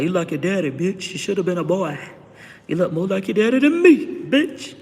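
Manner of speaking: loud, high mocking brother voice; brother's loud teasing jab; imitating her brother. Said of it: "my brother William used to always tell me that".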